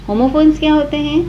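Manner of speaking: ordinary talk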